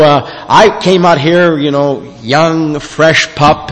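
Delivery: ordinary talk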